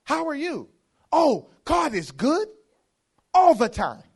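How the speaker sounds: loud, high mock church call-and-response; church lingo. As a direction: parody churchgoers trading stock praise phrases. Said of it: "you don't talk like this nowhere else".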